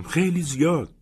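high, eager burst of joy; delighted. A narrator's reading. Boy thrilled by the cabin, answering with excited joy. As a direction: gush with delight like a thrilled teen. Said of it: "با شعف گفتم".

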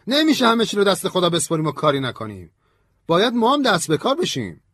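loud, high-pitched sharp objection; harsh, heated. A narrator's reading. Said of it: "ویویر با تندی گفت".

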